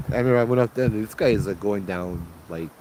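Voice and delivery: usual speaking voice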